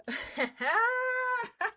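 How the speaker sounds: high excited giggle. Real, not acted. Streamer giggling over a package in the mail.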